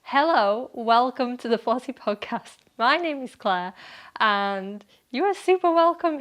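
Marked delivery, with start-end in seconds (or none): none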